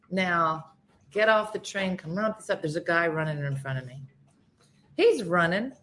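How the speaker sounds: normal talking voice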